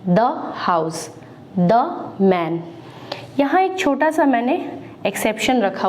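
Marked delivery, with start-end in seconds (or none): 0.1-1.2 द हाउस, short "duh", very slow; slow classroom model of द हाउस; the before consonant. Shown as a demonstration. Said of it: "हम उसको पढ़ेंगे द डॉग". 1.6-2.6 द मैन with a short "duh"; teacher saying द मैन clearly; the before consonant. Shown as a demonstration. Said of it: "हम उसको पढ़ेंगे".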